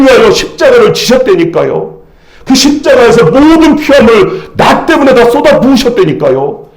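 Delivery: high-pitched, urgent sermon delivery; impassioned preacher in full flow; full-force preaching. Real, not acted. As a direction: press every phrase home to the congregation.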